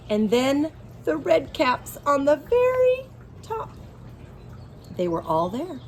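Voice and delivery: ordinary delivery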